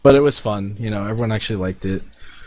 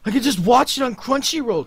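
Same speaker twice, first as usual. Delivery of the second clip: excited fanboy; 12 semitones higher than usual. Retold or imitated. Guy freaking out beside him.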